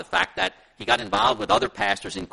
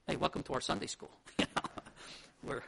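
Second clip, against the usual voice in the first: ordinary talk, nothing marked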